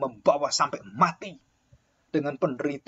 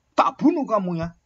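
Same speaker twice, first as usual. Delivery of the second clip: higher, louder threatening shout; mock threat; 6 semitones higher, 7 dB louder than usual. An older student threatening a rival over his girlfriend, angry shout. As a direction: bark the threat like a jealous senior.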